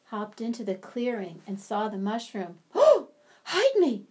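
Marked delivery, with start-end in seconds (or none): 2.7-4.1 high, louder panicked cry; frightened rabbit cry. Acted. Rabbit chased by a fox cries out in panic, high and loud. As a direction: yelp for help while fleeing. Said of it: "he cried".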